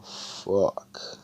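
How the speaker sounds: hushed, breathy whisper. Retold or imitated. Asta drifting into a nap, whispering. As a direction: whisper it as he dozes off. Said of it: "And he's just like".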